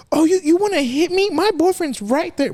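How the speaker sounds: high-pitched mocking voice, slowing on the boyfriend line; mock taunting woman. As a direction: mimic her daring you, high and taunting. He imitates her threatening.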